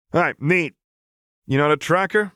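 a crass Piltover enforcer telling off a noble; rapid, scornful tough-girl rant; brash street enforcer Vi. Acted. Vi's voice, named dry.

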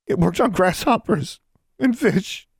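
high, crying voice; tearful. Acted. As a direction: say it through tears. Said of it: "Tears spilled out of her eyes".

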